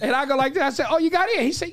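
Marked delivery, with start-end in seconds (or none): 0.9-1.4 higher, lightly amazed question; mock surprise. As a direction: act impressed that he got in. Retold surprised question to Todd.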